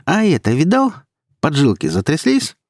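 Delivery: high, jeering threat; thug's taunt, swooping upward; taunting menace. Acted. Poacher taunts, brandishing a knife.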